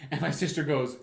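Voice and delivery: normal talking voice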